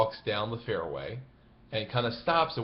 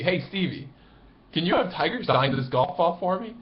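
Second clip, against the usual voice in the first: higher, eager ask pushing his luck; cheeky fan request; 8 semitones higher, 5 dB louder than usual. Retold or imitated. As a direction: chance one more favour, eagerly. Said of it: "he goes to Stevie".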